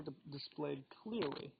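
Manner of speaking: ordinary talk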